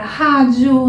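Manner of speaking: rádio with a throaty h-like R at the start; strong R sound. Shown as a demonstration. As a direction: say rádio with an English h for the R. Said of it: "Ele vai ter este som, que é um som mais forte".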